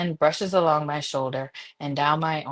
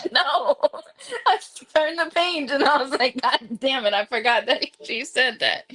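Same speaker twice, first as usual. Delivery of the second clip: laughing while talking; 9 semitones higher than usual. Real, not acted. Laughing at own mistake.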